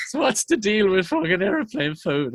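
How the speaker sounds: lightly put-on stage comedian voice; mock stand-up comic. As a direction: imitate a struggling comic pressing on. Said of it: "And I'm trying to go".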